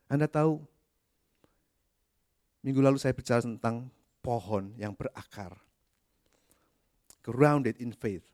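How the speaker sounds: ordinary delivery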